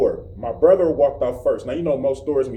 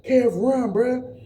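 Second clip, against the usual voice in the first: urgent warning; 8 semitones higher, much slower than usual. Retold or imitated. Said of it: "he was like".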